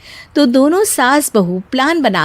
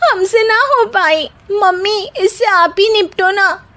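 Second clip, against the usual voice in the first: high frightened whimper; whining, higher, scared; scared whine; 9 semitones higher than usual. Acted. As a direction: beg her to handle it. Bride flees cockroach, whining.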